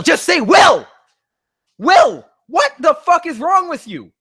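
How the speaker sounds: very high, loud screaming rant; shrieking outrage at the author; furious shout. Real, not acted. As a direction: scream your frustration at the writer. Screaming at the fanfic writer.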